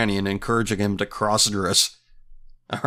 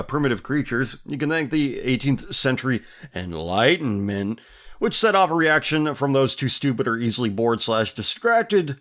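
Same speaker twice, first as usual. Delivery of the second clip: ordinary talk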